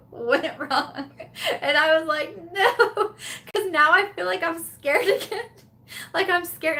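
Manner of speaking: high voice breaking into laughter; laughing through words. Real, not acted. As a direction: tell it helplessly giggling. Laughing while recalling the kitchen disaster.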